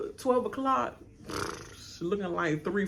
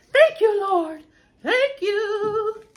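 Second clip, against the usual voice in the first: high, half-sung thanks; thank you Lord; 14 semitones higher, 4 dB louder, much slower than usual. Real, not acted. Grateful she got no ticket, high sung praise.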